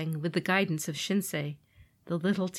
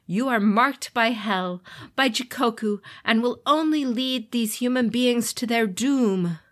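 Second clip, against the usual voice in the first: imperious authority; 5 semitones higher, 5 dB louder than usual. Acted. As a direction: pronounce judgement from a throne. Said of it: "imperious authority".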